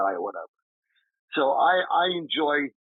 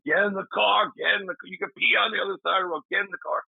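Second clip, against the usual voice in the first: retold yelling; 8 semitones higher, faster than usual. Al yelling at him to get in the car, mock yell. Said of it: "Al's yelling".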